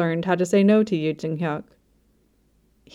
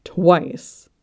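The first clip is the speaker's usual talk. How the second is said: one emphatic word, mildly outraged; a touch of indignation; rising outrage. A narrator's reading. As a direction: land it firmly, as if winning the argument. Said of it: "outrage rising".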